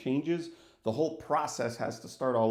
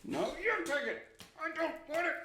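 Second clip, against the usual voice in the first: high, mock-whiny passing of the buck; hot potato squabble; 13 semitones higher, 4 dB quieter than usual. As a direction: toss the blame back and forth in a high, put-on voice. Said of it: "a game of, like, hot potato".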